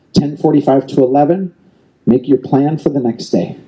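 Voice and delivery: ordinary delivery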